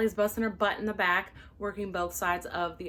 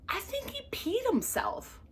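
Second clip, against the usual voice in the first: very high, slow, scandalized aside; scandalized coworker; 14 semitones higher, slower than usual. Retold or imitated. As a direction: deliver the gossip in disbelief. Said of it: "She goes".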